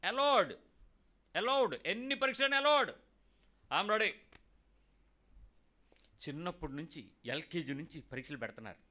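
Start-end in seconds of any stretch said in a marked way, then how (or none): 0.1-4.1 triumphant shout. Acted. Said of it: "defiant welcome of trials".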